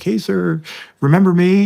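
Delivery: put-on high, loud, keen hello to an old friend; bright pitch-up as if catching him off guard; retelling an eager call to an old army buddy; eager retold greeting. Calling an old army contact.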